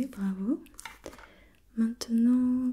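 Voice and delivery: ordinary talk, nothing marked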